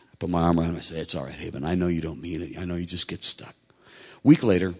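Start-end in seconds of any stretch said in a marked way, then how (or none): 1.0-3.6 low, soft forgiving tone; gentle reassurance. Retold or imitated. Father with arm round his sorry son, gently reassuring. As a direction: soothe him quietly.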